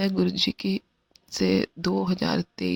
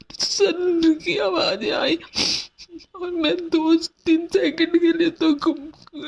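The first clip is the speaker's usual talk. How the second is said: high, loud and crying as she relives it; tearful breaking voice; 7 semitones higher, 3 dB louder than usual. Real, not acted. Telling the sangat how her husband hit her, crying. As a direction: voice cracking with real tears, pitched way up. Recounting being slapped by husband.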